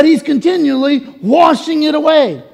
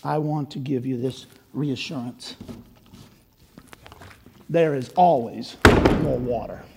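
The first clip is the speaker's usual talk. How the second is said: much softer, low and intimate; soft altar call; 9 semitones lower, 8 dB quieter than usual. Acted. Pastor closing the sermon, speaking softly to congregation. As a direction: draw them in gently.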